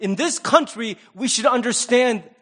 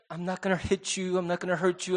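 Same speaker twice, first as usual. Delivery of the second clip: soft strained patience; 10 dB quieter than usual. Retold or imitated. Parent's patient reassurance quoted.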